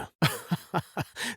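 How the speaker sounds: soft, high, breathy chuckle; quiet laugh that slips out; irrepressible chuckle. Acted. Apologising for an irresistible joke, soft chuckle. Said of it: "je n'ai pas pu m'en empêcher".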